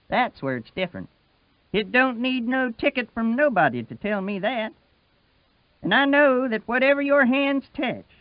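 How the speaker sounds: Wash's voice. Acted.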